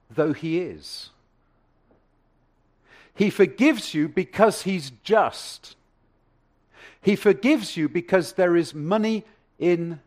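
slow, weighty build to a loud peak; preaching. Acted. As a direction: lay it down word by word, rising. Preacher builds to his main point.

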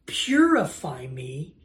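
slow, drawn-out plea; begging plea. Acted. David begs God for extreme cleansing, pleading. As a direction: stretch it out as an earnest plea. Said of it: "He's begging for the most extreme version".

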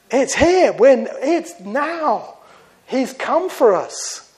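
high, loud, slow cry of joy; believer's ecstatic cry at the rapture; overjoyed cry. Acted. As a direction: gasp it out in rapture. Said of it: "it'll be like".

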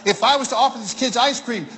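ordinary talk

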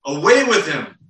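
loud, high crowd demand; the crowd crying out to Pilate, loud. Retold or imitated. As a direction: shout it as the angry mob. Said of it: "they cried out".

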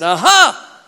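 loud, high triumphant "aha"; gotcha cry. Retold or imitated. Swimmer catches the preacher without tracts, loud gotcha. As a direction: pounce with a loud imitated gotcha.

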